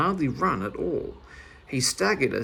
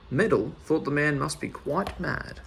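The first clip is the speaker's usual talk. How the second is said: usual speaking voice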